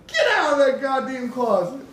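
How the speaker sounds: exasperated shout retold through amusement; exasperated order. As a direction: imitate a man ordering someone out. Retold man ordering girl out.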